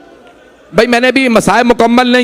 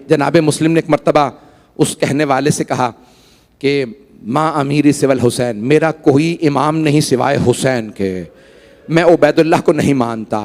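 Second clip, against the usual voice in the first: low, hushed, grave recounting of the martyrdom; sombre majlis narration; 8 semitones lower than usual. Acted.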